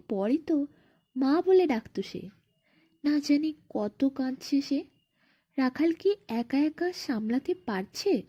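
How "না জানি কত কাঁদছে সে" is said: ordinary delivery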